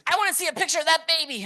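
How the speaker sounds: fast, very high, loud yell; hammy high-pitched celebrity demand; Richard Simmons. Retold or imitated. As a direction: shriek it out in a rush. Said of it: "I kinda do a decent impression".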